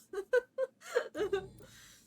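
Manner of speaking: a loud, high laugh near crying; high squealing laugh. Real, not acted. Laughs at the game.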